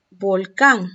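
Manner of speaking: Volcán said loud, weight on CÁN; stress on last syllable. Shown as a demonstration. Teacher introducing another example, final syllable of Volcán stressed. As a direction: punch the second syllable of Volcán. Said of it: "mayor fuerza de voz".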